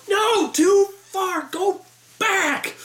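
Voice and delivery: high, long-drawn crowd holler; mock yell. Audience telling them it went too far, mock yelling. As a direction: stretch out each word as a held-back shout.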